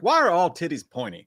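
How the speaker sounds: lightly voiced movie kid; quoting the character. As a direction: toss off the character's dumb line. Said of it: "and he's like".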